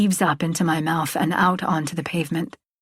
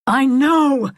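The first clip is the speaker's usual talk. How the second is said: loud, higher shout; exasperated raised voice; shouting back; 6 semitones higher, 5 dB louder than usual. A narrator's reading. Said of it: "he shouts back".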